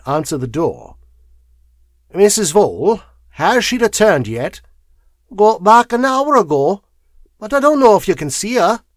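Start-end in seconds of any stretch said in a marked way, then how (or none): 2.1-4.6 higher, raised polite lawyer's question; lightly voiced visiting solicitor asking at the door; polite caller. A narrator's reading. Visitor's dialogue in story. 5.3-8.8 louder, high-pitched charwoman; high, loud servant's reply. A narrator's reading. Charwoman answers at the door.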